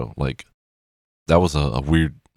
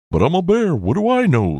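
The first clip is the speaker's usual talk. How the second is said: squeaky falsetto, much higher and louder; high silly voice; 14 semitones higher, 3 dB louder than usual. Retold or imitated. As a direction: pitch way up like a cartoon bear. Quoted movie line, silly voice.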